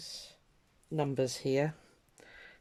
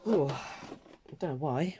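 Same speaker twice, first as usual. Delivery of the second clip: slow words stretched through a real yawn; slower than usual. Real, not acted. Crafter apologising to viewers, speaking through a yawn. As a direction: let a yawn drag the words out. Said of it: "do excuse me for yawning".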